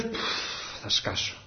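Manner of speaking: a whispered, breathless puff of strain; burdened puff. Retold or imitated. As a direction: sigh out the weight you can't hold. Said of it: "που λες".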